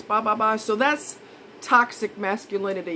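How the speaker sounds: ordinary talk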